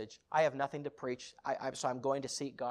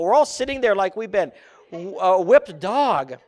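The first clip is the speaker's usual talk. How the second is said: loud dramatic emphasis; 4 semitones higher, 15 dB louder than usual. Real, not acted. Preacher telling of sitting on the front rows, loud dramatic emphasis. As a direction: build to a booming finish. Preacher heightens his story for the crowd.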